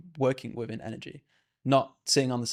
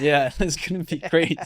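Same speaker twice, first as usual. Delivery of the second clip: higher, louder, slow words through laughter; laughing agreement; 4 semitones higher, 6 dB louder, slower than usual. Real, not acted. Co-host cheering on the guest's vision, laughing. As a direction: laugh while agreeing, drawn out.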